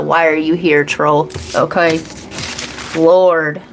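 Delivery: usual speaking voice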